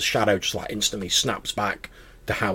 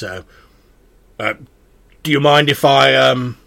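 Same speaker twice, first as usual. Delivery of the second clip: louder, ringing sword voice; a proud magic blade speaking; talking sword voice; 2 semitones higher, 7 dB louder than usual. Acted. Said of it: "The sword says".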